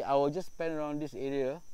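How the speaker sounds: normal talking voice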